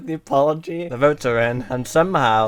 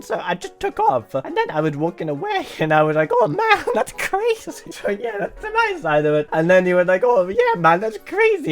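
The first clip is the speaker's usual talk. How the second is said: hammed-up fake Italian accent with leaping pitch; terrible Italian accent; 5 semitones higher than usual. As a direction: put on a badly faked Italian accent. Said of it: "Use a terrible Italian accent".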